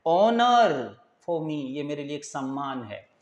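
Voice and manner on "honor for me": honor for me drawn out very slowly; teacher slowing right down; slow demo. Shown as a demonstration. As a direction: stretch honor for me for the class. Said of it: "This is an".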